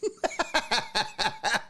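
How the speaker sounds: high, staccato chuckle; amused high-pitched cackle; laughing. Real, not acted. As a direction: laugh in a quick, even run of ha-has. Laughs at his own joke.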